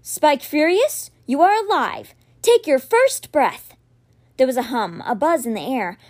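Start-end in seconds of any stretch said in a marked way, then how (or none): 0.0-3.7 slow, high, ceremonial incantation; solemn spell. A narrator's reading. Boy wizard awakens the whale, slow ceremonial call. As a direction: speak life into it slowly. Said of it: "He took a deep breath and said".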